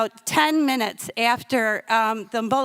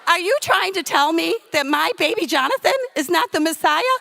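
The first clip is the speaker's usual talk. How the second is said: higher-pitched, offended comic outburst; indignant new mother; 5 semitones higher, 4 dB louder than usual. Retold or imitated. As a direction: challenge him in mock outrage. Acted indignant challenge to the rabbi.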